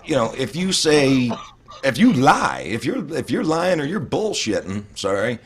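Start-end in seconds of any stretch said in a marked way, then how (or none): none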